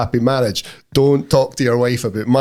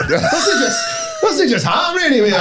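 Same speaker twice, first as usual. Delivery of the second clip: much higher, laughing half-shout; slower than usual. Real, not acted. Laughing rant to his mate.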